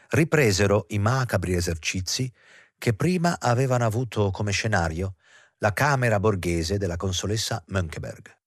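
usual speaking voice

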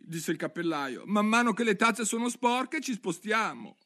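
high, jittery hatter voice; a frazzled hatter explaining; the mad hatter. Acted. Hatter explaining the tea cups to Alice, high.

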